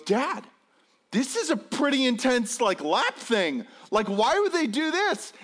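put-on high, anxious child's protest; nervous kid. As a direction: question it in rising alarm. Nervous son questions the lap bar.

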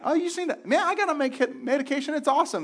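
mocking a careless friend bragging about medication; excited dude. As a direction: hype up the meds like a casual buddy. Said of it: "But people will say that".

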